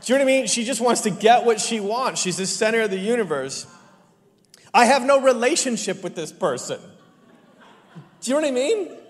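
loud, high preacher's build; full-force preaching. Acted. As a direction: drive the point home to the congregation.

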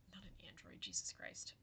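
muttering under breath; whispered. Real, not acted. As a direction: whisper it to yourself. Forgetting a word mid-sentence.

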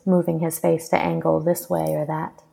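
ordinary delivery, nothing marked

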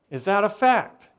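tough-guy Italian accent, hammed up; Italian mobster accent. Pastor mimicking a movie mobster, in a mock Italian accent. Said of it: "I'm not great at the Italian accent".

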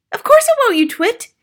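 very high, loud retort; mother snapping. A narrator's reading. Mother dismisses father's hope, snapping shrilly. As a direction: snap at your foolish husband. Mother snaps at her husband.

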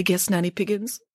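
ordinary delivery, nothing marked

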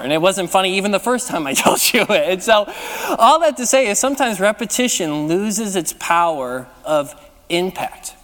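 ordinary talk

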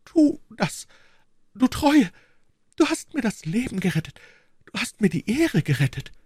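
high, grateful exclamations; joyfully excited. A narrator's reading. Queen thanks her brave servant, joyfully excited. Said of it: "rief freudig erregt".

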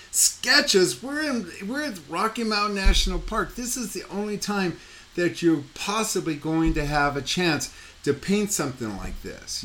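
high-pitched, indignant disbelief, hammed up; retold passionate protest; incredulous outburst. Retelling own outraged reply.

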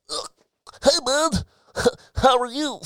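mock neck hugger. Mocking how someone would greet while hugging a neck, squeaky and hammed-up. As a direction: ham up an awkward over-tight hug hello.

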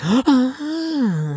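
long, high-pitched gasp of awe; mock delighted gasp. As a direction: gasp as if swooning over decor. Mimics women thrilled by mid-century style.

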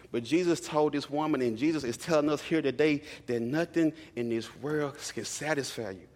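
ordinary talk

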